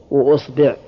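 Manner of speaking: وأصبع as "wa-usbi'", u first, i after ba; damma then kasra. Shown as a demonstration. Teacher completing the damma set, وأصبع as "wa-usbi'". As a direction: say وأصبع with opening u and second vowel i. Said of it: "ضم الهمزة".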